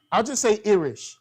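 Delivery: usual speaking voice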